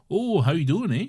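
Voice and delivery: mock Canadian accent, hammed up; mocking Canadians in a podcast chat, cartoonish Canadian accent. Podcaster parodying Canadians, mock Canadian accent. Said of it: "a cartoonish Canadian accent".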